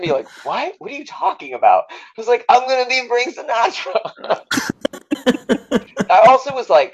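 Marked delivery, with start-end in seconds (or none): 0.4-1.8 confused castmates. Retold or imitated. 2.5-4.4 high, breathless thrilled reveal; giddy reveal. Retold or imitated. Actor revealing to his castmates, high giddy.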